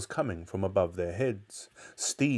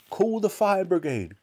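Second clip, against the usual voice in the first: high, panicked call for help; crying out; 10 semitones higher, 4 dB louder than usual. A narrator's reading. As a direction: cry it out urgently. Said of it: "cried Missus Cranky".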